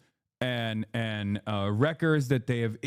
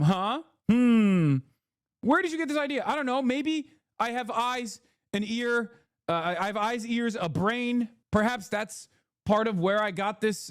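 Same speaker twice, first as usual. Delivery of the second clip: mocking gaslighter; 8 semitones higher than usual. Streamer mocking pro-Israel groups as a high whiny accuser. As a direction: ham up a fake-offended accuser. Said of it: "try to gaslight, to be like".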